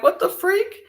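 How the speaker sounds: high squealed disbelief, far above normal; mock shock. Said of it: "I'm like".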